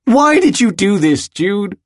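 high, loud, anguished demand; desperate yell. A narrator's reading. Willem, angry at himself and his friend, yelling desperately. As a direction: yell the question desperately at him. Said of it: "he yells, desperately".

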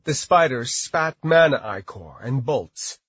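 plain reading voice

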